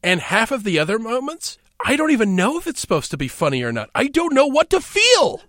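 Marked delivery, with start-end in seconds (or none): 3.9-5.4 high-pitched bewildered exclamation; exasperated outburst. Real, not acted. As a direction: blurt it out in comic despair. Exasperated outburst about confusing film.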